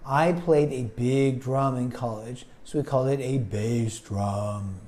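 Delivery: deep, low-pitched demonstration; low bass voice. Shown as a demonstration. Teacher illustrating a standard of sound, low bass voice. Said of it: "you have a very high voice".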